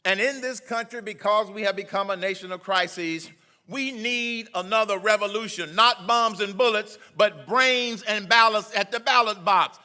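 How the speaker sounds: loud, high rally oratory, hammering the words; preaching at full force. Acted. Speaker rousing a rally crowd to revolution, loud preaching. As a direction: preach it to the crowd like a call to arms.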